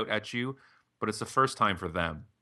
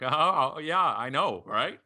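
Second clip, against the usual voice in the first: higher, louder mock agreement; playing along; 6 semitones higher, 4 dB louder, slower than usual. Guest retelling how he humored the woman at dinner, playing along. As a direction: go along with the joke.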